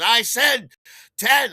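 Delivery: high, loud retort; heated insistence. Acted. Dealer arguing back at buyer, heated insistence.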